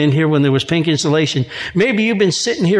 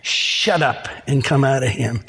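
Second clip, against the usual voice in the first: low-pitched dismissive command; talking to a dog; 5 semitones lower, 3 dB quieter than usual. Acted. Jesus talks to the devil like a dog, dismissive. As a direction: order it out like a dog. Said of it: "talked to him like a dog".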